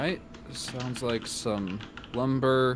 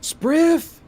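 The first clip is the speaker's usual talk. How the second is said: very high, loud call to the back room; shrill yell over her shoulder; yelling a name; 13 dB louder than usual. Acted. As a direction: shout for him across the shop. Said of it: "turns over her shoulder and yells".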